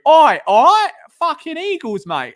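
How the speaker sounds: loud, high London lad shout; rowdy London "oi oi" call; very London accent. Shown as a demonstration. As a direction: burst into a brash London accent. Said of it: "there'll be times where I'm, like, very".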